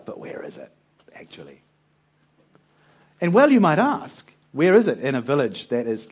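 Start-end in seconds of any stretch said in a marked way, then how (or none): 0.0-1.6 a hushed, doubting aside; whispered doubt. Acted. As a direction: whisper it skeptically. Gideon's doubting question.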